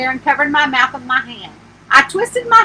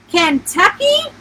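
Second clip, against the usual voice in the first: higher, slower, rising in disbelief; shocked question; 5 semitones higher, slower than usual. A narrator's reading. Client hears the campground is in Kentucky, shocked. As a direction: echo it back as a stunned question. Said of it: "My jaw dropped".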